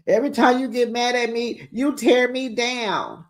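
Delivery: imitating a tearful castmate; mock confession. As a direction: plead like she's wounded. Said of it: "here go her confession".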